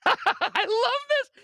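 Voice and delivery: laughing through the words; can barely get it out for laughing. Real, not acted. Host laughs while introducing the clip, laughing.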